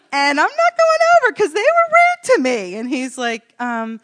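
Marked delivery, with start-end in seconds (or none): 0.1-2.8 high, loud acted protest; mock defiant yell. Woman refusing to apologize to girls, hammed-up defiant yell. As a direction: shout back in mock indignation. Retold defiant argument with the Lord.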